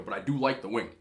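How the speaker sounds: usual speaking voice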